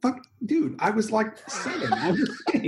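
high-pitched, laughing self-defence; laughing protest. Real, not acted. Host defends a childhood impression to teasing co-host, laughing protest.

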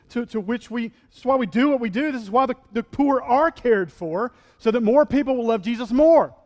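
full-force pulpit preaching; fervent preaching. Real, not acted. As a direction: drive the point home to the church.

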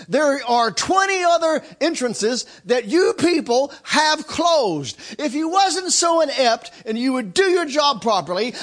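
loud, high furious refusal; indignant shout. Retold or imitated. Pastor yelling at the customs agent, loud and high. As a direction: dig in and yell at the official. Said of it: "I, I shouted".

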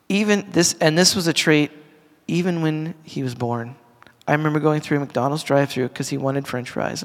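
ordinary talk

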